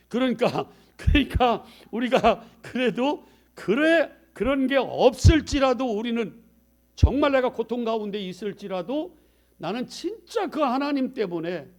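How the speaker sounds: high, loud sermon climax; full-force preaching. Acted. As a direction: preach it out with fire. (열정적으로 외치는 설교)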